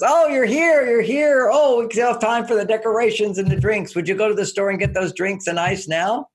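thrilled he's finally home; wife welcoming her late husband with joy; delighted wife. Retold or imitated. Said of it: "and she goes".

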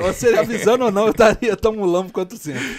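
loud laughing protest. Real, not acted. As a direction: laugh through the words. Laughing self-mockery.

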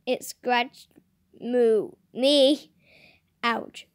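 ordinary talk

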